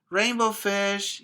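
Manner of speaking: high eager little voice; small pleading fish voice; little fish calling. Acted. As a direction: call after him hopefully. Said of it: "he called".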